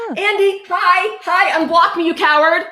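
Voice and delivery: high, loud, sing-song teasing jeer; mock taunt. Taunting Andy who is restreaming.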